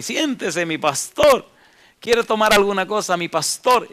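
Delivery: butter up the pastor; fawning rich man. Retold or imitated. Rich man fawningly offers drink. (rico zalamero, voz más aguda)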